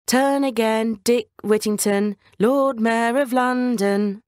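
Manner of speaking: drawn-out sing-song bells calling Dick; talking church bells. Acted. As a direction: chime the words out slowly like distant bells. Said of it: "the bells were saying".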